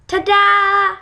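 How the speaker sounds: loud high ta-da; triumphant. Acted. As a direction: present it with a flourish. Frog shows golden ball.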